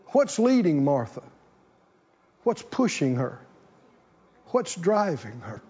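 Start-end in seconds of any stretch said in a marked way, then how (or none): none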